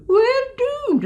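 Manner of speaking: very high, cartoony Scottish doom cry; mock catastrophe in a shrieky Scottish accent; Sergeant Fraser. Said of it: "I was like Sergeant Fraser".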